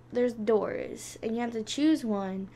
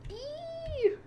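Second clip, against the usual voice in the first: high squeal. Real, not acted. Squeals as characters die.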